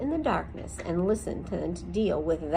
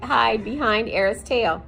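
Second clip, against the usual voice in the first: ordinary delivery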